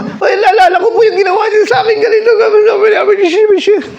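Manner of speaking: high, loud hammed-up crying; mock sobbing. Preacher mimics the camper wailing over her hurt, hammed-up sobbing. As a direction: wail it like an overdone sob.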